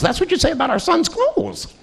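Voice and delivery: high, sharp wife's retort; retold angry jab. Wife jabbing at her husband over the Kleenex, sharply. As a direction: throw it back at him.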